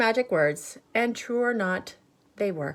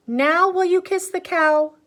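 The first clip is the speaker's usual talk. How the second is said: insistent mother; 11 semitones higher, 7 dB louder than usual. Acted. Mother asking again, pushier.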